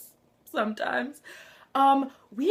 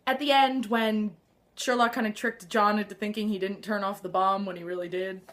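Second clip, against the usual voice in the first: ordinary delivery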